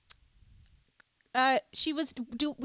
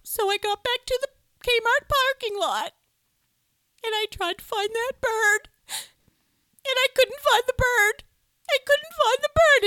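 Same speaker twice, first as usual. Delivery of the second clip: much higher, louder, crying voice; mock sobbing mom; 12 semitones higher, 7 dB louder than usual. Mom telling the family, mock sobbing. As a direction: build it into a hammed-up wail. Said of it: "sobbing speech".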